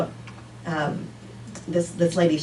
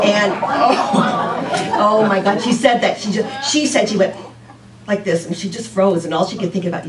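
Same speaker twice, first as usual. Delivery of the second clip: usual speaking voice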